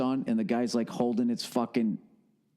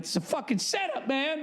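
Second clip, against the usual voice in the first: higher, panicked mouse voice realizing the trap; 11 semitones higher than usual. Retold or imitated. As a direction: squeak it out like a cornered mouse who knows he's been set up.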